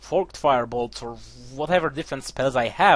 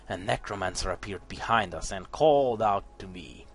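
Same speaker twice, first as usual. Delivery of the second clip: story narration; 4 semitones lower than usual. A narrator's reading. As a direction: read it like a dark fantasy tale.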